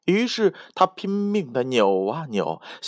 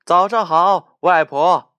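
dwarves greeting together, louder and higher; calling in unison; 4 semitones higher, 6 dB louder than usual. Acted. Seven dwarves greeting grandma together, loud cheerful call. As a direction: shout a cheery greeting as a group. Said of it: "他们齐声喊了一句".

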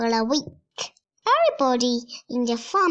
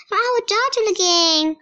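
happy cry; 7 semitones higher, 3 dB louder than usual. Acted. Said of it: "cries her pride and happily".

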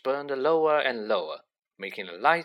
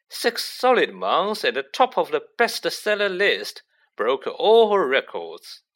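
pompous boasting, pitched up; self-satisfied Lockhart; 5 semitones higher, 5 dB louder than usual. Acted. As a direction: brag grandly about your own success. Figure of Lockhart in narrated story.

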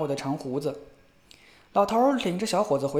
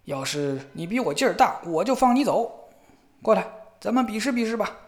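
大叫; 7 semitones higher, 3 dB louder than usual. A narrator's reading. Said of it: "老头大叫道".

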